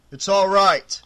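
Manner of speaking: loud shout over the panic; loud, shouted reassurance. A narrator's reading. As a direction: shout it to calm everyone down. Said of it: "Doug shouted".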